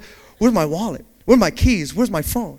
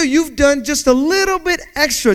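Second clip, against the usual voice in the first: ordinary delivery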